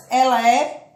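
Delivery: normal talking voice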